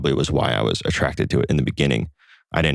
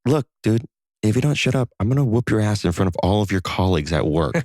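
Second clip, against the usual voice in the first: higher-pitched mock warning; retold heated threat; 6 semitones higher than usual. Young man warning the yeller at a party, retold threat. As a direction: act out a threat, starting high and coming down.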